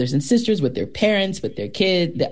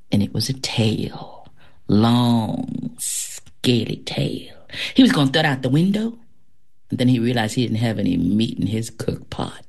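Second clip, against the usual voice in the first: folk tale storytelling; 3 semitones lower than usual. Acted. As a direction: spin a spooky folk tale, drawing out the creepy words. Performs a folk tale excerpt.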